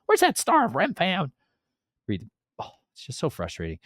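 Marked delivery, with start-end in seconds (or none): 0.1-1.3 very high, loud mock cheer; squeaky hammed-up party voice; mocking the people. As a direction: cheer like a silly crowd. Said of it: "They're like".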